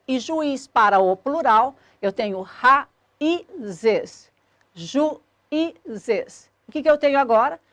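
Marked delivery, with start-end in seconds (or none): 2.4-4.3 "raízes" very slowly split, ra-í-zes; teacher's drawn-out breakdown of "raízes", the I alone; syllable by syllable. Shown as a demonstration. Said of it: "Esse I fazendo hiato tônico e formando sílaba sozinha". 4.7-6.5 "juízes" split into ju-í-zes; teacher separating each syllable of "juízes". Shown as a demonstration. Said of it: "Esse I fazendo hiato tônico e formando sílaba sozinha".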